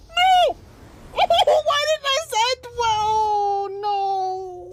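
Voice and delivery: very high, loud, slow wail; real anguished shout at the game; despairing wail. Real, not acted. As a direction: cry out at losing progress. Lost progress without saving.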